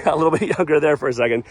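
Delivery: ordinary talk, nothing marked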